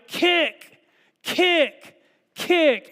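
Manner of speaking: loud, high repeated kick chant; yelled kick. Retold or imitated. Fan in the stands urging a runner, shouting. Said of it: "You yell".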